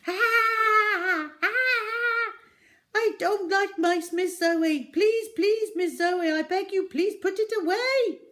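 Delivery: very high, loud panicked pleading; shrieking shopkeeper terrified of mice; terrified screaming. A narrator's reading. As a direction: scream and beg from on top of the counter. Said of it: "stood for quite a while screaming".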